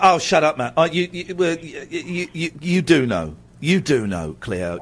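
normal talking voice

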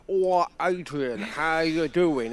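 slow, put-on lopsided-mouth voice; street cleaner impression. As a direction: imitate a man talking from one side of his mouth. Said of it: "He sounds a bit like that".

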